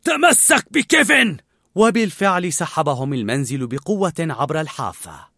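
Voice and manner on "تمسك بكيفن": loud, slow high shout. A narrator's reading. Old man warns boy as house lifts, shout. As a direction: yell the warning over the noise. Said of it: "فصاح".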